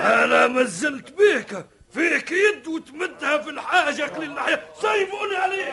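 high furious yelling; angry shouting. Acted. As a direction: yell it out in rage. Shouting in the fight.

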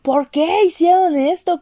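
high pitched cry of disbelief at a show; high, half-laughing outcry; mock outraged yell. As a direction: wail it, betrayed by the writers. Retelling screaming at the show.

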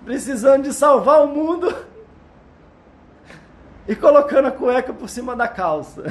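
laughing while speaking; giggling through the words. Real, not acted. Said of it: "tô chorando de rir aqui".

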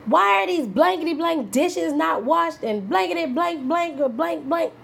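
high, loud exasperated parent shouting about dishes; hammed-up rant at the kids over unwashed dishes; a mother pretend-yelling about the blankety blank dishes; mock angry yell. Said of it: "So I can say".

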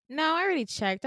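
a hint of a brisk nurse cutting in.

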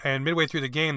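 ordinary talk, nothing marked

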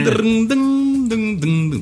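high sung harp plucks; imitated harp. Repeating the harp CD tune for a friend, imitated harp. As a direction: hum out plucked harp notes. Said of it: "C D clássico de harpa".

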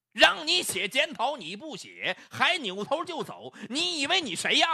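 high-pitched tirade, peaking at the end; angry bellow. A narrator's reading. Manager berating the clerk entering his office, bellowing. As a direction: berate her, building to a furious penalty. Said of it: "刘飞就大声吼起来了".

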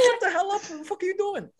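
high-pitched exasperated scolding; retold yell. Storyteller telling classmate to shut up, retold high exasperated yelling. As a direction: squeal it in frantic disbelief. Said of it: "I yelled at him".

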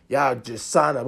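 normal talking voice

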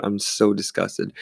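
ordinary delivery

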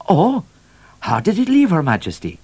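high-pitched, inquiring trooper voice. A narrator's reading. Trooper asking the governor after Her Majesty, high and deferential. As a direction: ask it high, with an attentive "Oh".

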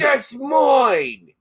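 put-on high shouted New Zealand accent; Kiwi accent shout. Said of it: "now you sound Australian".

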